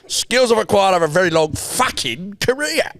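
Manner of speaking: high-pitched, sweary gangster swagger; Alfie. Acted. Gangster boasting of his skills, high and swaggering. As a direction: swoop up and down through the boast. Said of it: "Right, fucking Alfie".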